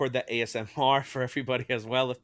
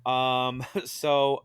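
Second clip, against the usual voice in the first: ordinary talk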